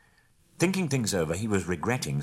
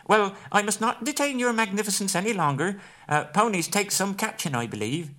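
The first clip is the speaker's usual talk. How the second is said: much higher, teasing hobbit voice, louder at the end; cheeky parting shot; 14 semitones higher, 5 dB louder than usual. A narrator's reading. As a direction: toss off a sly jab as he runs. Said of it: "as a parting shot".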